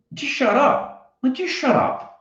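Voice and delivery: high-pitched, drawn-out exasperation; exasperated. Retold or imitated. Comedian to the laughing parking lot owner, exasperated.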